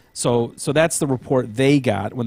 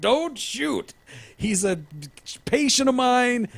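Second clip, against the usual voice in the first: high pleading voice, slower at the end; imitating Kinsey; 12 semitones higher, slower than usual. Host voicing the therapist on the ground, high pleading. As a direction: beg the cops in a high pitch. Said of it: "and he's like".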